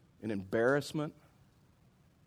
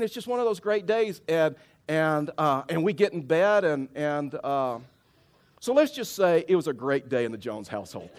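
usual speaking voice

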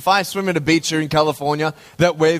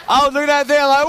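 excited retelling; 11 semitones higher than usual. Retold or imitated. Telling the church about the wave, excited and high. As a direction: relive the wipeout at high speed.

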